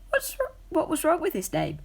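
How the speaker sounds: a small cat taking offence; high young cat voice. A narrator's reading.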